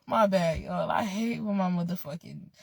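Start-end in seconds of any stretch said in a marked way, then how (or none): 0.1-0.9 laughing apology. Real, not acted. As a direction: giggle out an apology.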